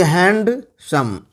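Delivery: HANDSOME broken as "hand... सम"; split into syllables. Shown as a demonstration. Teacher counting syllables for students, handsome split in two. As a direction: say handsome in two separate beats. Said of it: "एक से ज़्यादा syllable है".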